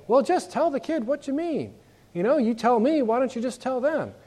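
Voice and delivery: high, mock impatient grown-up; impatient adult. Adult walking in scolding the parent, mock impatient and high. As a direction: nag the parent to just say it. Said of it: "they don't say".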